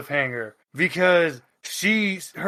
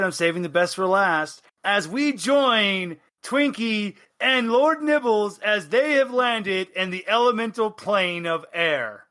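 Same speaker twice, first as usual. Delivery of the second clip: usual speaking voice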